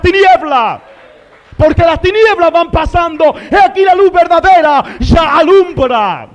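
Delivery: loud, high, ringing proclamation; full-force preaching. Real, not acted. Preacher proclaiming light over darkness to the church, full-force preaching. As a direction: build to a shouted climax.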